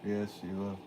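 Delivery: usual speaking voice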